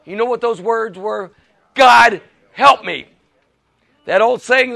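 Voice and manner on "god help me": loud, slow desperate cry for help; retold scream. Recovering drinker reliving his plea, loud slow cry. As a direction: cry out to God from the edge. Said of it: "I was screaming".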